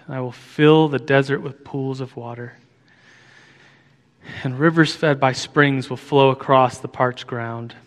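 tender, quiet reading of scripture. Acted. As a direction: read the verse with hushed reverence. Reads Isaiah aloud.

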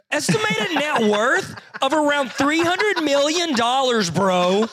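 high, drawn-out disbelief; amazed announcement. Real, not acted. Co-host reveals a huge net worth, amazed. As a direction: stretch the big figure slowly and high. Host blurts the big number.

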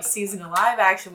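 ordinary delivery

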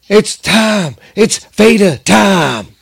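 announcer voice. Acted. Announcing Vader's entrance.

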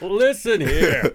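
slow, high cackling codger; old prospector. Acted. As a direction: drag it out like an old codger, laughing. Old-man voice, laughing.

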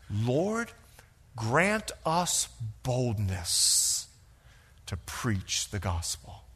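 slow, low, soft prayer; hushed prayer. Retold or imitated. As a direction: pray it gently, slowly. Peter prays.

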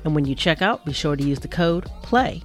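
usual speaking voice

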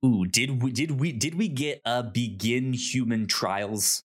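ordinary talk, nothing marked

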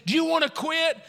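high-pitched, mock stern demand; chief challenging. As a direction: put it to him as the chief. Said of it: "And he says".

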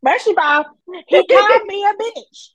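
loud, high, outraged; indignant outburst. Pretend. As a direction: tell it like you're still offended. Retelling fight with crow.

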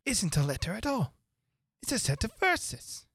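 high, surprised little voice. A narrator's reading. Rabbit unfolds the paper.

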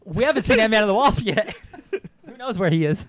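laughing. Real, not acted. Podcaster joking to co-hosts about Ant-Man, laughing. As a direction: crack up while saying it.

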